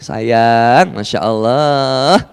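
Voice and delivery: slow, hushed cooing to the motorbike; mock tender. Man hugging his motorbike, mock tender coo. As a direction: croon to it like a sweetheart, slowly.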